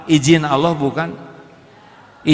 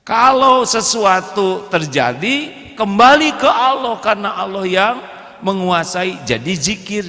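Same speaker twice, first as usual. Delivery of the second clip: ordinary delivery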